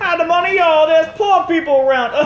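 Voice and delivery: high, loud put-on woman's voice; mock woman voice. Quoting the woman warning about poor people, high and loud. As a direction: squeal it like the skit lady. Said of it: "she says".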